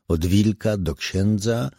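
ordinary delivery, nothing marked

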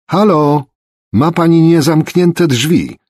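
loud, high, drawn-out shout; high-pitched, insistent holler; almost screaming. A narrator's reading. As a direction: yell a warning into the house. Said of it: "krzyczała wręcz".

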